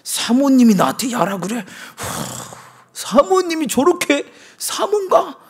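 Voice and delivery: high, loud, offended whining; indignant whine. Retold or imitated. Preacher mocking his younger self offended by the pastor's wife, high and loud. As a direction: act out a sulky young man's outrage. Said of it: "그때 깨달았어야 되는데".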